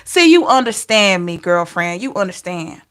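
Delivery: ordinary talk, nothing marked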